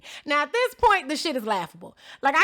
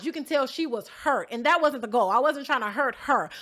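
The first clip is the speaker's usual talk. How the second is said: ordinary delivery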